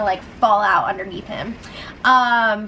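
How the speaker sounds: ordinary talk, nothing marked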